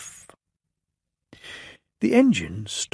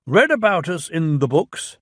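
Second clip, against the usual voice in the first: raised, pompous official voice; Fat Controller voice; 7 semitones higher, 4 dB louder than usual. Acted. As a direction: speak as a stern railway boss.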